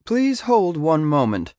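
high, slightly uncertain grandmotherly voice; hesitant receptionist. A narrator's reading. Receptionist unsure about the student caller, hesitant voice. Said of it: "In a hesitant voice".

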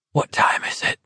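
unvoiced sick whisper. A narrator's reading. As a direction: breathe the question. Sick man asks suddenly.

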